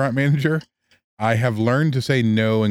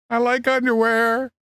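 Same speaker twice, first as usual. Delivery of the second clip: high silly voice; 10 semitones higher than usual. Pretend.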